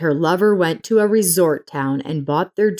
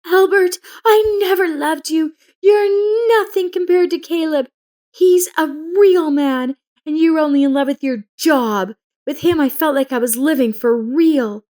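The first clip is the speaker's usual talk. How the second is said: hysterical shout; 10 semitones higher than usual. A narrator's reading. Frida shouting at Albert during her arrest. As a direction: scream it at him through tears. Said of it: "Frida shouted".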